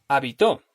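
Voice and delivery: habitó landing on final TÓ; stress on last syllable. Shown as a demonstration. Teacher showing meaning changes with stress, habitó stressed on TÓ. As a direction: save the punch for -TÓ in habitó. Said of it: "cómo vaya a pronunciarlo".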